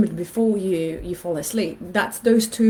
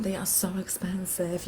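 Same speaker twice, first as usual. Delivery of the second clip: whispered aside; 10 dB quieter than usual. Retold or imitated. Shocked at shoe price.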